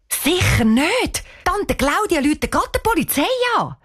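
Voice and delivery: hoher, alarmierter Widerspruch; entschiedene Abwehr. A narrator's reading. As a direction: lehn es aufgeregt und entschieden ab. Cheyenne refuses to tell aunt. (empört und hoch)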